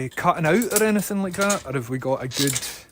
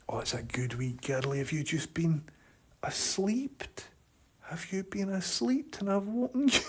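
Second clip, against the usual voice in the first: tender whisper to dog; 3 semitones higher, 10 dB quieter than usual. Real, not acted. Talking softly to the dog.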